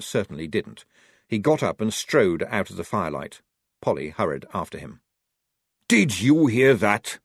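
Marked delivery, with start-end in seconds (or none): 5.9-7.2 slow, louder snarling sergeant; snarled. Acted. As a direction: snarl it, seething. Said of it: "snarled the sergeant".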